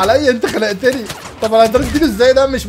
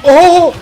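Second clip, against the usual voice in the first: loud, high "oho!"; real burst of surprise; surprised whoop; 9 semitones higher, 10 dB louder, much slower than usual. Real, not acted. Sudden event in the game.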